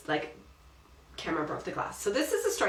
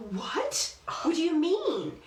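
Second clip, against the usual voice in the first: high, indignant "what?"; livid; 9 semitones higher, slower than usual. Retold or imitated. Wife retelling her reaction to husband breaking glass, indignant and high. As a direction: retell the outrage with a high shocked bark. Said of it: "And I was livid".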